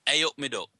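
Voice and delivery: Nottingham accent demo, "A up me duck". Shown as a demonstration. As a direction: teach it in broad Nottingham accent. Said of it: "say, this is a Nottingham accent".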